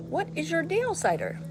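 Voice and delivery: high teasing pet voice; dog-talk voice. Acted. Asking the dog what's wrong, high playful voice.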